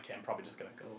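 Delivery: a nervous whisper to himself; whispered aside. Retold or imitated. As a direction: whisper it under your breath. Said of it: "I'm like".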